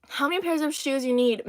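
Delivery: ordinary talk, nothing marked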